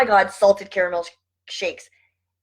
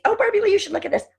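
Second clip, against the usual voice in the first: gushing sender; 13 semitones higher, faster than usual. Retold or imitated. People sending her things.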